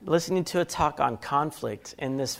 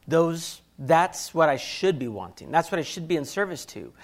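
normal talking voice

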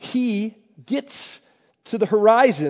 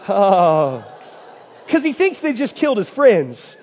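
usual speaking voice

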